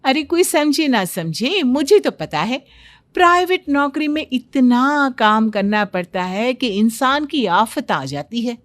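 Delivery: lightly acted boastful young wife; a touch of dramatic self-importance; know-it-all daughter-in-law. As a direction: play it knowing and emphatic.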